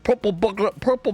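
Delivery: high, stumbling "purple burglar"; failed tongue twister. Retold or imitated. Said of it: "he realizes he can't say it".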